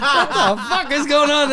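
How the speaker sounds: loud, high, bewildered outburst; mock panic. Comic retelling his horror after dropping the glass, mock panic. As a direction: exclaim it high and loud through the laughs.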